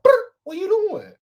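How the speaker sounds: high, loud mock phone ring then smooth greeting. Young man calling the older woman, playful ring-and-greeting. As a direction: trill the ring, then slide into a come-on.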